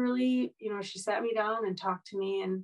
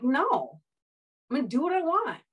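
grandma's defiant refusal, a bit louder and higher; angry defiance; 6 semitones higher, 3 dB louder than usual. Retold or imitated. Grandma refusing family intervention, defiant and angry. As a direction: push back hard, like a stubborn grandma. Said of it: "she was real angry with them".

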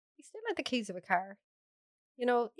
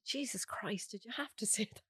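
whispering; 8 dB quieter than usual. Retold or imitated. Kids scolding her in shop.

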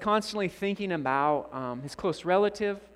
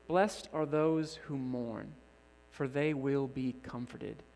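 slow, soft recollection; 4 semitones lower, 5 dB quieter, slower than usual. Acted. Verse recalled quietly in grief.

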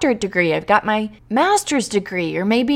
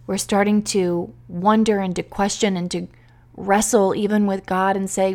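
ordinary talk

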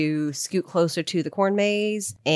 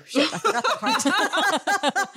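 laughing high and slow; 14 semitones higher, slower than usual. Real, not acted. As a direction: laugh through the embarrassed admission. Laughs at own mistake.

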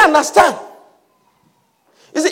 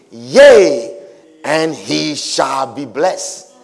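very deep, slow decree; solemn pronouncement; 12 semitones lower, much slower than usual. Acted. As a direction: pronounce it low and weighty. Isaac solemnly confirms the blessing.

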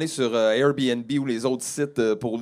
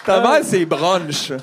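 slow joke; 3 semitones higher, 3 dB louder, much slower than usual. Acted. Joking, very slow.

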